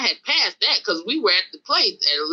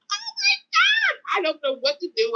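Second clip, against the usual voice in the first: panicked cry; 14 semitones higher than usual. Retold or imitated. Driver panicking as the attackers climb forward, high wail.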